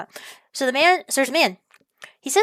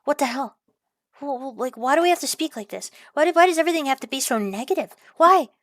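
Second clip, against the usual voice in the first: mock exasperation; 4 semitones higher than usual. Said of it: "I'm just like".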